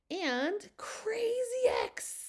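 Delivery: high, drawn-out fanfare on each word; playful announcement. Real, not acted. Teacher unveiling a fun phonics rule to viewers, playful and drawn-out. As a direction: stretch it out with big, singing excitement.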